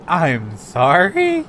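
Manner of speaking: incredulous. Retold or imitated. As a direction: stretch it out in disbelief. Disbelief at no-show staff.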